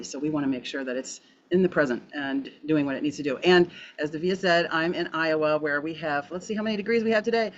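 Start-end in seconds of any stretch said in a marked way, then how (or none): none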